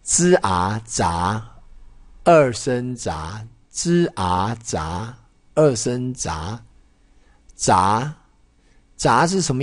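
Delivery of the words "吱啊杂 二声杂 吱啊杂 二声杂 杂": 吱啊杂 slowly blended, then 二声杂 with a rising second tone; slow syllable drill. Shown as a demonstration. Teacher showing students how to read 杂, slowly. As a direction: break 杂 into 吱 and 啊, then rise on 杂. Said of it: "它这怎么念？".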